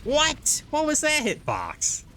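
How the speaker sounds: shrieking high in disbelief; outraged yelp at the game; raging gamer. Real, not acted. Gamer hit by unfair hitbox.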